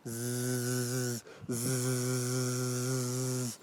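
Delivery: soft, sustained droning buzz; bee buzzing. Shown as a demonstration. Poet leading children in a bee sound, steady buzzing. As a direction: hum a long steady "zzz". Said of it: "make the sound of a bee".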